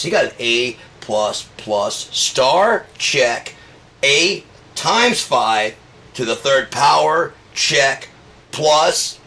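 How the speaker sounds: slow, loud, rising into mock awe; building mock hype. Comic ranting to crowd about Trump's grades, building mock hype.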